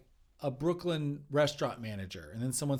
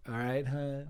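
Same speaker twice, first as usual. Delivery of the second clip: sleazy guy voice; slower than usual. Acted. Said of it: "I'm already doing the, the character a little bit".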